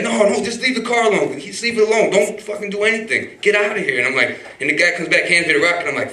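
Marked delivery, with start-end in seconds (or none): 0.0-4.0 alarmed warning. Retold or imitated. Plumber warning them off the car.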